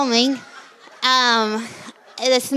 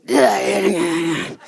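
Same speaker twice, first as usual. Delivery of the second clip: lightly voiced Nick Nolte; 2 semitones lower than usual. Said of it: "He's like".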